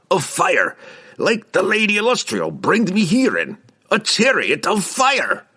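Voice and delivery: high, forceful, insistent growl; growling dwarf. A narrator's reading. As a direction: growl it out, dragging the last phrase. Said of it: "Bruner growled".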